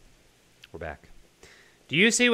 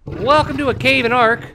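high, raised voice in the chaos; loud, worked-up shout; 10 semitones higher, 9 dB louder than usual. Real, not acted. Gamer fleeing an attack, shouting to viewers.

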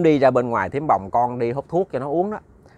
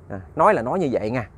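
ordinary delivery, nothing marked

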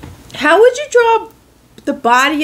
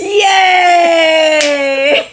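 loud happy cheer; excited cheer; 4 semitones higher, 4 dB louder than usual. Real, not acted. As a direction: cheer in delight. Celebrating the right guess.

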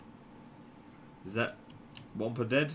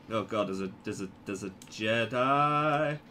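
usual speaking voice

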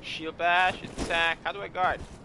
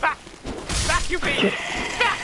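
angry shout; 8 semitones higher than usual. Real, not acted. Fending off enemy in game.

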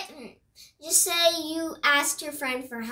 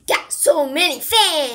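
louder; 8 dB louder than usual. Real, not acted. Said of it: "I still don't hear you".

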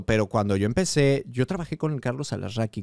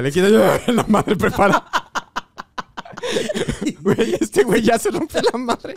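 laughing; 9 semitones higher, 5 dB louder than usual. Real, not acted. Laughing while telling nose story.

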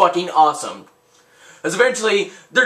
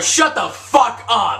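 drawn-out, higher-pitched yell; mock angry yell; 4 semitones higher, slower than usual. Gamer yelling over his own streetcar joke, mock angry. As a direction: yell it slowly, hitting every word.

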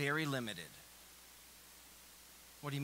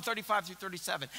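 normal talking voice

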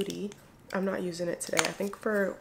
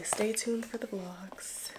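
whisper; 7 dB quieter, slower than usual. Real, not acted. Teasing upcoming vlogs to viewers.

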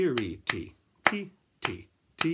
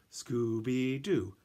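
ordinary delivery

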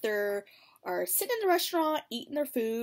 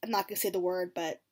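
ordinary delivery

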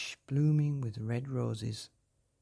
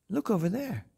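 excited cry; 9 semitones higher, 4 dB louder than usual. A narrator's reading. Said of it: "cried Gerda".

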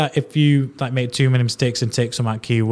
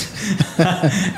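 laugh; 7 semitones higher than usual. Real, not acted. Laughs about automating his voice.

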